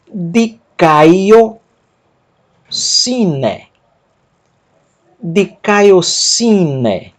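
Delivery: each syllable drawn out very slowly; slow Greek demo. Shown as a demonstration. Teacher models Greek for students, very slow. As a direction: spell the word out slowly for learners. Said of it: "Vou ler o texto".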